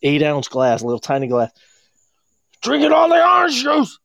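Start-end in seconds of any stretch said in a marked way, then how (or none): 2.6-4.0 much higher, hammed-up scolding shout; mock angry dad. Friend's dad over orange juice, mock scolding shout. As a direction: bark like the stingy angry father.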